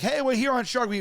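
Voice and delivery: high, cheery TV host greeting; mock presenter pep; show host intro. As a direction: imitate an upbeat episode opener. Re-enacts upbeat episode intro.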